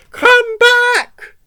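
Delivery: very high, loud shriek; yelled call. A narrator's reading. Missus Potter yells after the dog stealing sausages, high shout. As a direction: call after a runaway at full pitch. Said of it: "yelled Missus Potter".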